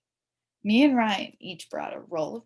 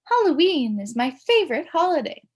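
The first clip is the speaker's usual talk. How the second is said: a school secretary on the PA, higher and louder; crackly loudspeaker voice; 8 semitones higher, 9 dB louder than usual. A narrator's reading. Secretary announcing to the school over the loudspeaker, crackly and raised. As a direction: crackle it out like an old school intercom. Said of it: "crackled over the loudspeaker".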